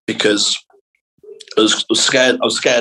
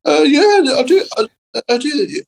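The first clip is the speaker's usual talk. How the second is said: high-pitched nervous stammer; timid kid put on the spot, voice shooting up high. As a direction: squeak it out haltingly, far above your usual voice. Acts out his scared younger self.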